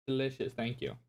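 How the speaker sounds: talking through a mouthful of soup; chewing while talking, mouth half full. Real, not acted. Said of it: "I'm trying to eat some of the food".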